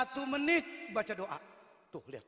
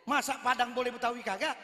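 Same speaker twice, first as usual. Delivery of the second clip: normal talking voice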